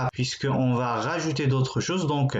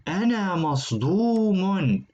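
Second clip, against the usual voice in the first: acted shock; 6 semitones higher than usual. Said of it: "Celui-l est choqué".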